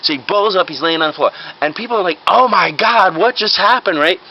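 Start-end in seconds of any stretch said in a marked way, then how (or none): 2.3-4.0 higher-pitched, acted alarm; shocked bystander. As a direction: react like a startled onlooker. Bystanders react to man collapsing.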